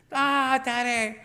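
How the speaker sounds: high, loud imitated child wail; a hammed-up kid howling; tantrum scream. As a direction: screech as a kid being dragged out. Son screaming while carried.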